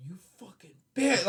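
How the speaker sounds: inner dark rage. Retold or imitated. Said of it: "I felt like this darkness come out of me".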